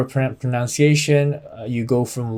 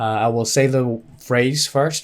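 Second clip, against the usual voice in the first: ordinary talk, nothing marked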